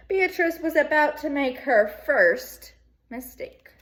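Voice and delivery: lively read-aloud to second graders; bright classroom storytelling; storybook reading. Acted. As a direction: read it like story time for kids.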